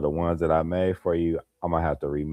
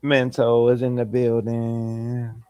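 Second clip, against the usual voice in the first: hype announcer call; 6 semitones higher, slower than usual. Acted. Hyping a viewer's arrival.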